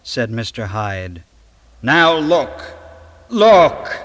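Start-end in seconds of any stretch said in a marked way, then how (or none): 1.8-2.5 commanding. Acted. Hyde shows his transformation. 3.3-4.0 high, loud shout on "look!". Acted. Hyde yelling at Lanyon to witness the change. Hyde shows his transformation.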